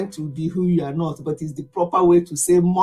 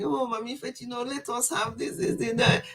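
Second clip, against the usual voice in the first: mock-timid, much softer voice; talking small; 4 semitones higher, 9 dB quieter than usual. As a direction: mimic someone talking small and shy. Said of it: "and you talk small, small like that".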